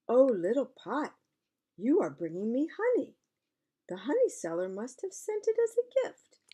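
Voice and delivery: a mother thrilled by a gift; delighted mother. Acted. Mother greeting little pot, high and delighted.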